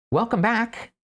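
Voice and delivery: high little mouse voice. Acted. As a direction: voice the tiny mouse, pitched high. Mouse greets waking narrator.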